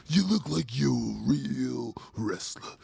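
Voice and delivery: loud, slow, drawn-out hype voice; wrestling hype. Acted. Commenter voice swells into wrestler hype.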